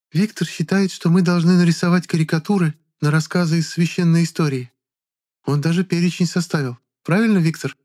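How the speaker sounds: high, adoring coquettish voice; fawning woman. A narrator's reading. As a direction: gush over him sweetly. Irina fawning over Viktor. (восхищённая кокетка)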